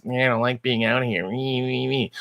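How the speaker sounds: mocking whine, softening into mewling "me, me, me"; whiny Kirk. Host mocking the wrongheaded bored captain, in a whine. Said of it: "this character that's like".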